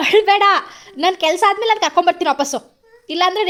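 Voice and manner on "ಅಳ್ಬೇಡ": higher, louder don't cry; firm call. Real, not acted. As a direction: raise your voice to stop the crying. Mother tells crying child stop. (ಜೋರಾಗಿ ಅಳಬೇಡ ಎಂದು ಗದರಿಸುವುದು)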